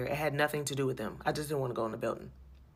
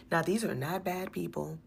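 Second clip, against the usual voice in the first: usual speaking voice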